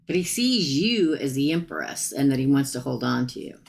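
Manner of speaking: ordinary talk, nothing marked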